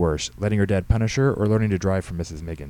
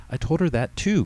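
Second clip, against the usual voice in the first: put-on higher-pitched, lively girl's excuses; teenage daughter explaining; 10 semitones higher than usual. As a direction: play the daughter justifying herself to Dad. Voices teenage daughter Katie.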